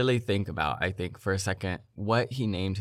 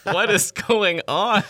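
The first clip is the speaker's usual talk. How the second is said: much higher, louder and slow through laughter; cracking up in disbelief; laughing disbelief; 11 semitones higher, 7 dB louder, slower than usual. Retold or imitated. Reacting to the Elon clip, laughing high and loud. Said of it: "I'm like".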